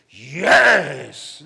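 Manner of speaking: loud, drawn-out cry of resolve; exultant yell. Retold or imitated. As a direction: seize the moment with a big yes. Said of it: "No, I went".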